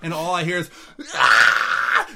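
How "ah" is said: short, sudden loud scream; imitated scream. Retelling what was heard from the house, full-on scream. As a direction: burst out with a mock scream. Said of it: "Just full on screaming".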